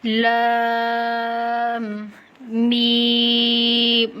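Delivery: لام and میم each held very long on a level note; slow tajweed chant of لام، میم; drawn-out letter names. Shown as a demonstration. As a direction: sustain the vowels of لام and میم. Models each letter for learners.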